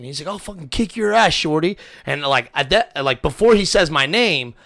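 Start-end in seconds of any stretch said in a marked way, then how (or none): 0.3-1.8 high-pitched mock yell; retold drunken threat, pitched up. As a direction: imitate a drunk yelling a threat. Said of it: "he starts yelling at me, cussing and screaming".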